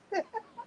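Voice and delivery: high breathy laugh. Real, not acted. Laugh after "I am a woman.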